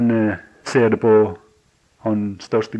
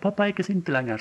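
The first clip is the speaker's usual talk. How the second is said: lightly voiced small boy, high and relieved; a hint of a child, voice lifted high; little son's voice; 9 semitones higher, faster than usual. A small son telling his father, lightly voiced, high and relieved. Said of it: "og så plutselig så sier han".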